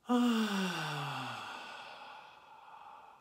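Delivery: calm them into the breath; guided meditation. Acted.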